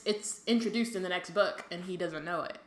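normal talking voice

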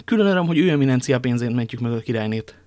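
loud, gleeful declaration; exclaiming. A narrator's reading. Young man delighted to use the Cardinal's money, exclaiming. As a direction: relish the irony out loud. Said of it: "kiáltott fel D'Artagnan".

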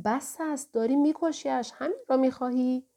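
high, loud cry to make him stop; shouting. A narrator's reading. Narrator shouting at the innkeeper to stop beating him. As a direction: yell out in protest, pitched high and loud. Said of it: "فریاد زدم".

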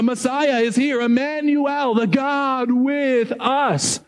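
usual speaking voice

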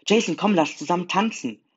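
high, loud, eager invitation; calling out. A narrator's reading. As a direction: call a brother over to dance. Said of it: "rief sie mich". (laut und hoch gerufen)

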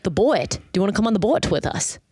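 Minnesotan boat, drawn into two syllables; thick Minnesotan accent. Retold or imitated. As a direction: imitate a thick Minnesota speaker saying boat as bo-at. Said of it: "you're saying two syllables".

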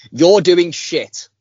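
slow, spelled-out teasing jab; over enunciating. Shown as a demonstration. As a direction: say each word slowly, like to a child. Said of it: "I'll say it slower".

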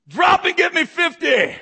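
high, loud, drawn-out barked order; drill sergeant. Acted. Drill instructor to a new recruit, barked order.

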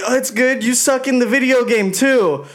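high, mocking drunk voice; drunk taunt. Friend mimics drunk Noah taunting the player, high mocking voice. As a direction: ham up a wasted guy teasing. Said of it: "Noah was like".

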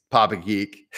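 normal talking voice

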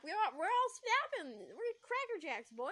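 high, silly exclamation; snapping. Acted. Narrator declaring everyone is snapping, silly outburst. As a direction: squeak it out as if cracking up. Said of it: "Jeremy's voice is what made me snap".

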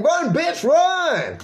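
high shouted yell. Retold or imitated. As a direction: yell at her to run. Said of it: "and yelling".

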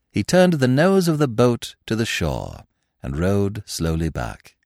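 ordinary delivery

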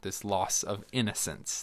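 ordinary talk, nothing marked

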